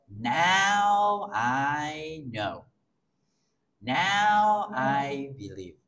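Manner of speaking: very slow, drawn-out dawning belief; slow conviction. Retold or imitated. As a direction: let the realization sink in slowly. Prospect's conversion quoted.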